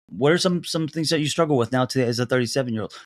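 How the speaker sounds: normal talking voice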